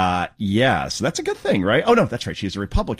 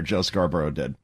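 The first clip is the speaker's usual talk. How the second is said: usual speaking voice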